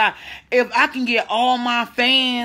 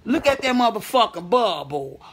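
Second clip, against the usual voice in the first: normal talking voice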